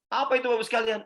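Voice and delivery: usual speaking voice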